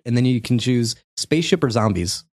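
ordinary talk, nothing marked

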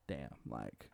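very low, barely voiced mumble; deflated, hushed retelling; defeated. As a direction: let it drop low and quiet, imitating defeat.

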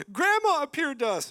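high, loud excited mimicry of relatives; hammed-up gushing relative; mocking relatives. Preacher mimicking relatives who saw grandma, high and loud. Family members reporting grandma's ghost.

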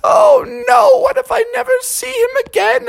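very high, hammed-up wailing; squeaky theatrical sobbing voice; tearful mother. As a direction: wail in exaggerated motherly despair. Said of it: "Mother especially was tearing up".

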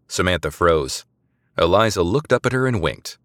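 plain reading voice

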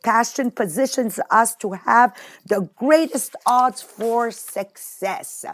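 usual speaking voice

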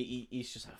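ordinary talk, nothing marked

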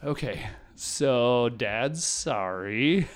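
very slow, drawn-out sheepish sorry; dad apologizing to kids, sheepish; sheepish apology. Retold or imitated. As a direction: eat humble pie, stretching it out. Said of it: "swallow my pride and be like".

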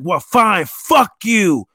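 pop-up insulting the user, mock angry. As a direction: spit it out like a sore loser. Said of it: "It's like".

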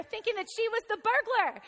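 ordinary delivery

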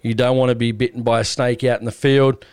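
ordinary talk

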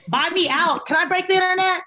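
rally the fans; playful self-hype; hype call. Real, not acted. Hyping merch drop.

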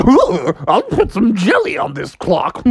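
gleeful character voice breaking into a cackle; Mad Hatter. Acted. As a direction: relish the mischief, then laugh madly. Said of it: "He said".

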